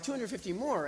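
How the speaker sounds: high, disbelieving question; incredulous echo. Retold or imitated. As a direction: echo the figure in mock astonishment. Astonished echo, retold once more.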